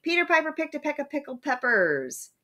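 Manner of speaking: high sing-song with each stressed syllable punched: PEter PIper PICKED a PECK of PICKled PEPpers; trochaic stress. Shown as a demonstration. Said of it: "Let's take a look at some trochaic feet".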